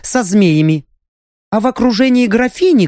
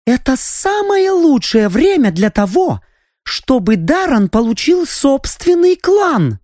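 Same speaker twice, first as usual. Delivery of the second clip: high, eager and lively; excited; 8 semitones higher than usual. A narrator's reading. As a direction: push the idea with rising excitement. Countess excited by her idea. (воодушевлённо)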